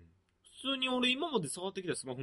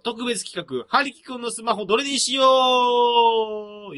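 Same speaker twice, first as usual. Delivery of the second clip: announcer shout; 7 semitones higher, 9 dB louder, much slower than usual. Acted. Announcing special segment title.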